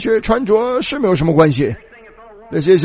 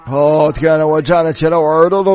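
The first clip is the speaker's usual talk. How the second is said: ordinary delivery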